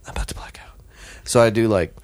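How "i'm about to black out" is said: barely voiced, out of strength; whispered desperation. Near-drowning swimmer pleads with the captain, breathless whisper. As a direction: whisper it as if about to faint.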